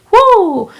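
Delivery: high, loud celebratory "whoo"; proud whoop. Acted. Guessing someone's accent right, a proud cheering whoop. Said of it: "that we feel so proud".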